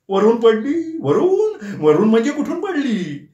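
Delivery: high, rising, shocked repeat; can't believe it fell from above; astonished disbelief. A narrator's reading. Nana astonished at Shekhar's briefcase story.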